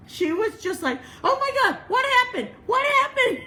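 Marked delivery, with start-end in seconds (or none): 1.2-3.4 high, loud panicked questioning; acted-out frantic worry; alarmed. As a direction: cry out in alarm, high and urgent. Alarmed lady rushing in, retold.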